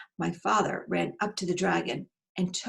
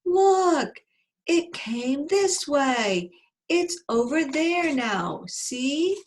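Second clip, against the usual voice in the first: high, slow, drawn-out holler; boar's scream; 8 semitones higher, 5 dB louder, much slower than usual. Acted. Wild boar spots the escape and alerts the others, high screaming. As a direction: cry out the sighting to the whole pack. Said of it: "he heard a wild boar scream".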